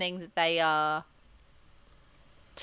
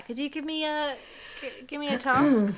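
TV presenter voice; 5 semitones higher than usual. Acted. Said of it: "as the T V presenter".

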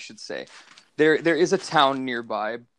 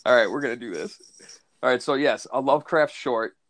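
normal talking voice

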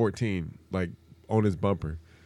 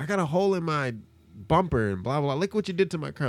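mock upset older lady, pitched way up; high shrill lady voice; 7 semitones higher than usual. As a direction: squeal it like an indignant lady. Angry lady voiced in story.

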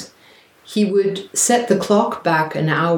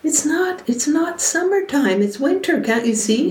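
much higher, sing-song mock of a condescending partner; mocking gaslighter; 10 semitones higher than usual. Gaslighting partner talking down to you, mocking high voice. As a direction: mimic someone insisting summer is winter.

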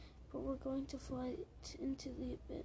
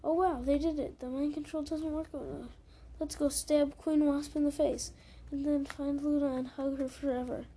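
louder, gleeful imagined victory speech; rally the troops in pretend glee; mock triumphant cheer; 8 dB louder than usual. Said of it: "you'll be like".

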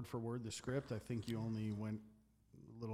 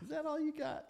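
much higher, louder sneering challenge; quoting the film line in a high mock taunt; 4 dB louder than usual. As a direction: goad him like the movie boss. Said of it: "Oh my God".

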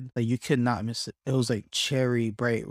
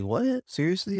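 high-pitched, incredulous reaction; shocked disbelief; 7 semitones higher than usual. Retold or imitated. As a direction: imitate a guy stunned by the news. Imitating the shocked other guy.

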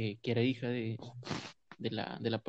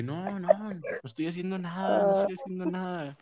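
panicked denial; 7 semitones higher, 5 dB louder than usual. Retold or imitated. Caught, defensive denial retold.

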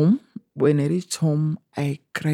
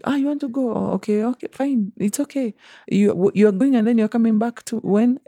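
higher, syrupy boss voice; lightly voiced boss, sweetly higher; mock sweet employer; 7 semitones higher than usual. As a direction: play the fake-friendly employer, pitched up. Employer voiced in retold exchange.

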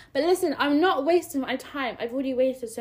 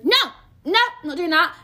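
loud, higher-pitched protest; emphatic refusal; 5 semitones higher, 5 dB louder, slower than usual. Acted. Rejecting being shy around a fit guy, loud refusal. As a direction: reject it forcefully, voice up. Rejects her imagined timid self.

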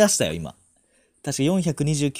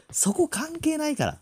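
exasperated outburst; 4 dB louder, slower than usual. Real, not acted. Exasperated at partner's pricing fuss.

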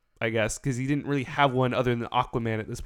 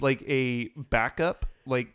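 ordinary talk

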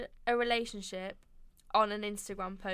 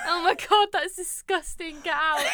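louder, hammed-up horrified tease ending in a laugh; mock disgust; 6 semitones higher, 6 dB louder than usual. Teasing her friend about the boy band, she plays loud mock disgust. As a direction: pretend to be appalled, half laughing.

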